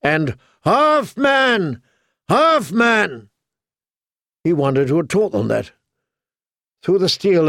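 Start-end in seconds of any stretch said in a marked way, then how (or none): 0.6-3.3 high chanted cheer from the troops; high-pitched rallying call, falling at each end; ragged battle cry. A narrator's reading. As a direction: chant it like men rallying to their leader. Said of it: "his men cried raggedly".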